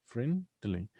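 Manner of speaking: "Friendly" broken into friend, ly; two separate syllables. Shown as a demonstration. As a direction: count out both syllables of "friendly". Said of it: "we have two syllables here".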